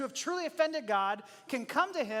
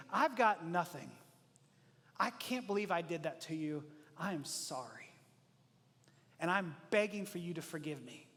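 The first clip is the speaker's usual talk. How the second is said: softer, lower, broken-down apology; humble remorse; 6 semitones lower, 5 dB quieter than usual. Acted. Preacher voicing a remorseful friend, softer and lower. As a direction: drop the voice, nothing left to defend.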